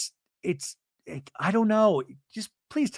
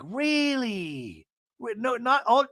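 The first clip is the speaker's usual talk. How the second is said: sincere surprise; 8 semitones higher, much slower than usual. Retold or imitated. She's not even being facetious.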